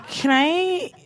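put-on halting drunk man's ask, with long pauses; drunk request. Drunk stranger asks woman to touch her hair, halting and tipsy. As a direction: play a drunk stranger working up to a question. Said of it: "he was really drunk".

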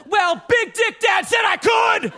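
high, shrill whining yell; bratty teen shout. Retold or imitated. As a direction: scream it like a spoiled kid. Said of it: "he's just like".

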